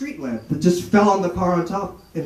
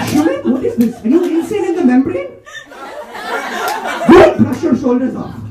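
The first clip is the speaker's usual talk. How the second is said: scolding Indian mother, raised pitch; Indian accent; 10 semitones higher than usual. Retold or imitated. Indian mother quoting rap lyrics in an Indian accent. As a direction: Indian mom rapping at her son. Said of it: "say them in Indian accents".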